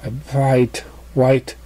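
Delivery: plain reading voice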